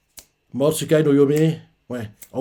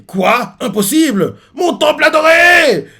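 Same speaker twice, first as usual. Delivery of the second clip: a hammed-up cry rising to a much higher, louder yell; anguished shout; 13 semitones higher, 7 dB louder than usual. The guy seeing his temple wrecked, building anguished shout. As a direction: wail over a ruined temple, building to a scream.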